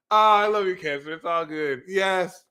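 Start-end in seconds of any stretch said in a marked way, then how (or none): none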